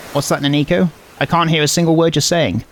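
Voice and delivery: ordinary delivery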